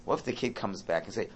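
ordinary talk, nothing marked

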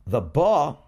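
ordinary talk, nothing marked

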